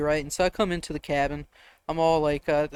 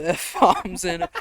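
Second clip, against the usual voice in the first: usual speaking voice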